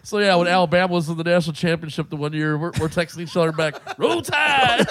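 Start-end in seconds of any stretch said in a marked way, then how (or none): 4.0-4.9 high, gleeful rallying cry; hammed-up cheer, pitched way up; mock team cheer. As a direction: call it out like a rabid fan. Quoting their texted cheer.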